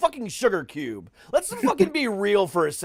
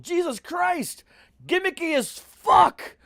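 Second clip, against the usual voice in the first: exasperated shout; 9 semitones higher, slower than usual. Real, not acted. Ranting to his co-host about astronaut ice cream, slow exasperated shout. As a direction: throw the words out, fed up. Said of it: "You're really passionate about that fucking ice cream".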